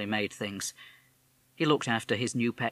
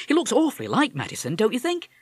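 hasty; 10 semitones higher, 4 dB louder than usual. A narrator's reading. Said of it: "His mother plunged in hastily".

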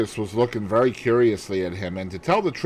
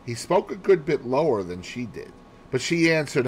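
usual speaking voice

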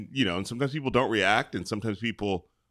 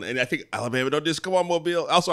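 normal talking voice

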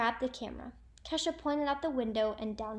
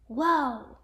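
loud, amazed exclamation; impressed; 2 semitones higher, 8 dB louder than usual. A narrator's reading. Carlos marvelling at what Kesha knows, impressed. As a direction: let it burst out in wonder.

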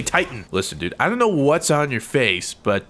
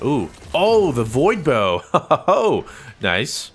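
usual speaking voice

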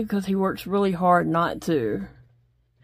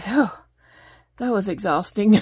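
normal talking voice

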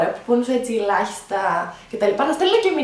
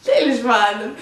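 laughing through tears; 5 semitones higher, 3 dB louder, slower than usual. Real, not acted. Laughing-crying while recalling the pain.

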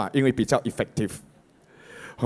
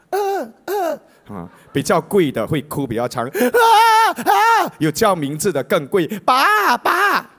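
high, hammed-up mock wailing; fake-cry for a paying client; a hired wailer calling out for the father; professional mourner; 9 semitones higher, 5 dB louder than usual.

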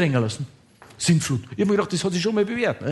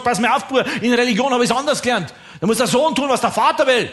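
high, insistent mock scolding; indignant father; 6 semitones higher than usual. Father lectures son who plays with him, mock indignation. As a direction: lay down the law, hammed up.

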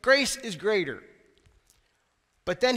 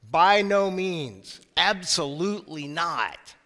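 slow, deliberate "By no means"; very firm; slower than usual. Retold or imitated. Preacher voicing Paul to the church, very firm and slow. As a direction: lay down "By no means" slowly and firmly, each word weighted. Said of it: "says very firmly".

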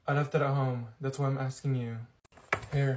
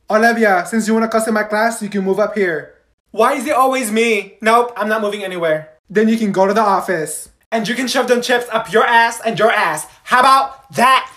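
loud scolding teacher; angry teacher; 4 semitones higher, 7 dB louder than usual. Acted. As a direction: scold the class loudly. Teacher scolds cussing students.

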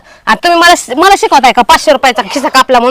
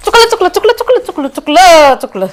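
frantic apologies; 3 semitones higher than usual. Acted. Wife pleading sorry fast.

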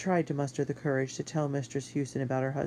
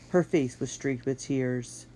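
ordinary delivery, nothing marked

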